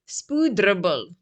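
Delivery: spoedrubbel slowly in an Afrikaans accent; careful Afrikaans spoedrubbel. Shown as a demonstration. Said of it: "a proper Afrikaans ac-, accent".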